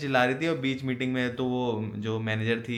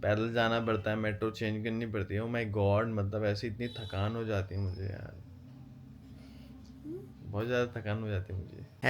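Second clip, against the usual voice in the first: sarcastic mock; 2 semitones lower, 8 dB quieter than usual. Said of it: "sarcastic वे में बोल रहा है".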